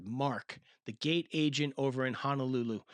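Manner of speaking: ordinary talk, nothing marked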